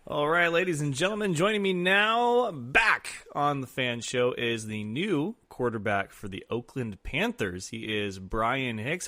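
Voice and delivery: slow, drawn-out guest introduction; drawn-out talk-show host intro; show announcer introduction. Acted. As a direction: present the guest like an announcer, stretching the big words.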